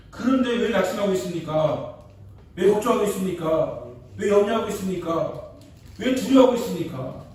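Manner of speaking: preaching at full force. Real, not acted. Pastor challenging his worried congregation, fervent rising preaching. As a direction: press the flock with every why.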